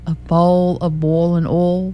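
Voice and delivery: slow, stretched Southern drawl on bowl and oil; a thick Southern accent, slowed right down. Retold or imitated. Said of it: "southern drawl".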